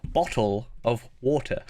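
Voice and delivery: slow, over-careful British "Bottle of water," ending on a rise; mock-posh British "Bottle of water," said slowly; exaggerated British. Shown as a demonstration. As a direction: stretch out "Bottle of water," in a hammed-up British accent. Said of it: "exaggerate it".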